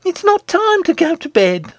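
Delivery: high shrill goblin queen. Acted. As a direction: squeal it like a spoiled queen. Goblin queen voiced in story.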